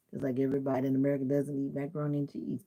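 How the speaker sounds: ordinary talk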